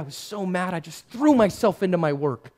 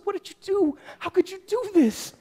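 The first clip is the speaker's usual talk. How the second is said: higher, pained confrontation; anguished demand; 12 semitones higher than usual. Retold or imitated. Joseph confronting Mary about the pregnancy, anguished. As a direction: demand an answer, hurt and betrayed.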